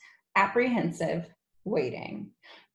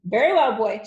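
loud, high, brusque address; imperious headmistress voice; domineering headmistress; 4 semitones higher, 8 dB louder, faster than usual. Acted. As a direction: turn menacingly on a pupil. Trunchbull turns on Wilfred.

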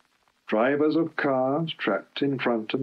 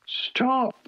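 very high wailing plea; 14 semitones higher than usual. Acted. Stuck Bulgy wails to Duck in fear, very high. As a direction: wail in panic. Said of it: "wailed Bulgy".

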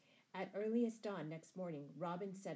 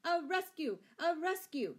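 high, loud rallying cry; outlaws' shout; 11 semitones higher, 10 dB louder than usual. A narrator's reading. Outlaws rallying to save a comrade, shouting. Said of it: "shouted the outlaws".

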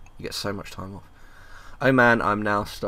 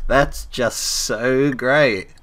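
very drawn-out, higher sarcastic delight; milk every word, pitched up; mock enthusiasm; 4 semitones higher, 4 dB louder, much slower than usual. Repeating the line after laughing, mock enthusiasm.

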